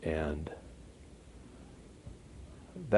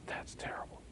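"That's terrible" breathed out in a whisper; 19 dB quieter than usual. Acted. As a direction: whisper the repeat. Whispered repeat.

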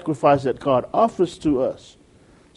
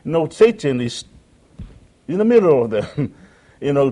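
normal talking voice

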